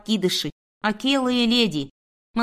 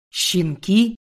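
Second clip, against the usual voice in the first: man's scornful echoed question; contemptuous; 3 dB louder than usual. A narrator's reading. Man sneering at the woman's claim on the puppies, contemptuous. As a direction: throw the word back with contempt. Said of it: "В мужском голосе послышалось презрение".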